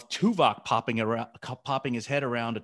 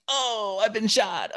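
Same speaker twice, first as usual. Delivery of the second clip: much higher, hammed-up cry of being hit; mock getting shot; 11 semitones higher, 3 dB louder, slower than usual. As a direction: overplay being shot, up high. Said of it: "Delancey's face of him going like".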